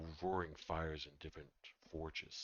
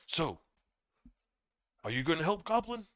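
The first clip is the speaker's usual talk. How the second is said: teasing dwarf; 9 semitones higher than usual. Acted. GM voices Thorgold, higher.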